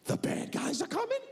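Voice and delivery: high-pitched alarmed question; alarmed child. Retold or imitated. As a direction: squeak it as a frightened kid's question. Son's alarmed question, retold.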